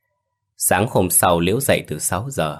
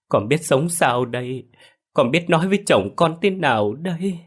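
wailing lament; 5 semitones higher than usual. Acted. Liễu kneels by the bodies, wailing for show. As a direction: cry out to the parents, performing grief. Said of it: "gào khóc liên hồi".

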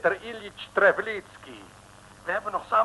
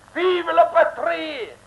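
patriotic cry; 11 semitones higher, 5 dB louder, much slower than usual. Acted. Frenchman toasts the fatherland.

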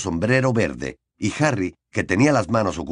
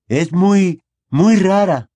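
higher, louder and delighted; beaming; 9 semitones higher, 7 dB louder, much slower than usual. A narrator's reading. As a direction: gush about it, beaming. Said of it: "afirmó Neville radiante".

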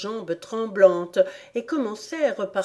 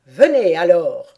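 commanding; 10 dB louder than usual. A narrator's reading. Queen summons Alice to the procession, loud command. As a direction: command her to follow. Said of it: "commanda sa majesté".

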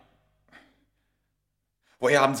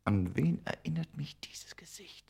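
slow whispered wondering; puzzled whisper; 7 semitones higher, 6 dB quieter, slower than usual. Acted. Smith staring at the mummy, slow puzzled whisper. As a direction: whisper it as if to yourself.

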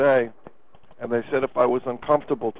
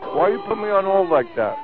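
higher, louder, irritated caller; annoyed; 6 semitones higher, 4 dB louder than usual. Acted. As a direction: push back like you're fed up. Caller angry about being put on hold.

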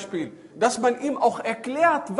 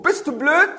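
high, loud rising question; echoed taunt; 8 semitones higher, 9 dB louder than usual. Acted. Forest voice repeats hunter's insult, loud echo. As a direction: fling the insult straight back. Kommt die Stimme zurück.

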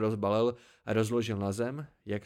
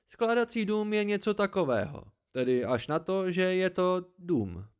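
higher, louder, emphatic hermit explanation; lightly voiced hermit explaining; 7 semitones higher, 5 dB louder than usual. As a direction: explain it emphatically, drawing out the ending. (poustevník lehce důrazně vysvětluje)

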